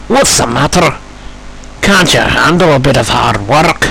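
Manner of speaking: mocking, needling taunt; teasing. Duncan needling tired engines about hard work, teasing. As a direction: tease a rival with a put-on voice. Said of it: "Duncan teased".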